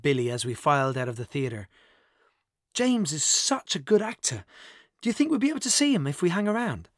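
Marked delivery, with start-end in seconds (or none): 2.7-6.9 high-pitched, eager gushing; starstruck excitement. A narrator's reading. Friend hopes to meet the actor after the show, excited. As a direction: gush like an excited fan.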